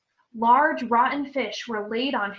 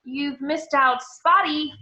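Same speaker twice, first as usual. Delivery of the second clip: hissed; 4 semitones higher, 6 dB louder, slower than usual. Acted. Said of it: "Peeves hissed in her ear".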